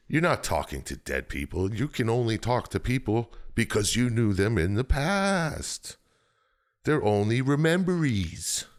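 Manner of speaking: high, hammed-up mystic; mock cloaked figures. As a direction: voice the hooded strangers, lifted and odd. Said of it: "They're like".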